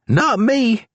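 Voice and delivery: deputy insulting the prisoner; Charlie's voice. A narrator's reading. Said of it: "Charlie added".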